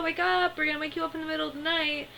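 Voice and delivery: high, rushed excited quote; high-pitched, hurried mimicry; excited mock cry. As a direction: blurt it out high and fast. Acting out excited waking-up quote.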